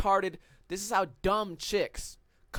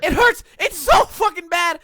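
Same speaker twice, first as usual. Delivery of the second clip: pained outburst; 9 semitones higher, 9 dB louder than usual. Real, not acted. Complaining about slapped tattoo.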